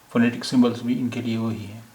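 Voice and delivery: usual speaking voice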